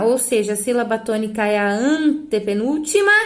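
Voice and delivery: usual speaking voice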